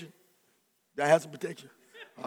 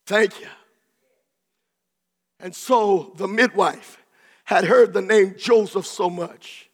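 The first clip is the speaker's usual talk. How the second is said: usual speaking voice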